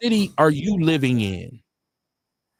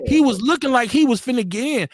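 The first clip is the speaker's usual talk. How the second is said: normal talking voice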